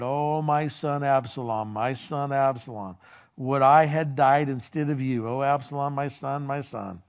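ordinary talk, nothing marked

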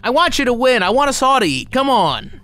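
high-pitched, emphatic cheering-on; root for them with the voice pushed up; impassioned plea. Real, not acted. Impassioned appeal to video makers.

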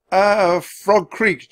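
high, hesitant then sudden answer; blurted. A narrator's reading. Jack cornered by centurion, blurting it out. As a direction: blurt it out after a nervous uh. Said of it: "Jack blurted out".

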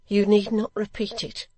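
high, anguished widow; stifled groan. A narrator's reading. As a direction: say it through suppressed distress. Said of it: "with a stifled groan".

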